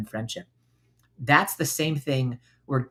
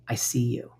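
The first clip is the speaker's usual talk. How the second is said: whispered tender reassurance; 3 semitones lower, 5 dB quieter than usual. Shown as a demonstration. Speaking kindly to her own hurt part, whispered. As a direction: whisper it like comforting a hurt child. Kind self-talk demo.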